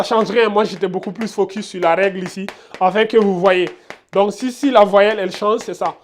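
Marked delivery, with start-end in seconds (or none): none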